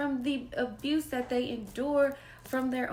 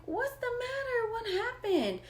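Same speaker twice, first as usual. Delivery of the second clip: much higher, anxious concern; worried mom. Retold or imitated. Mother asking her crying daughter what happened, high and worried. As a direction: ask your upset child what's wrong, voice lifted high.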